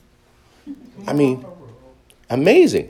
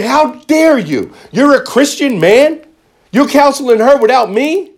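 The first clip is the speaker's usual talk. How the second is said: mock furious outburst, much higher and louder, slow; retold fury; 9 semitones higher, 5 dB louder than usual. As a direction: replay the angry confrontation with the counselor, much higher and louder. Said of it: "I was furious with him".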